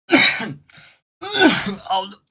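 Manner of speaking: coughing and choking out a feeble reply; hammed-up sick act broken by coughs; faking sick. Worker faking sick to managers, putting on a coughing sick act. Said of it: "start putting out the act".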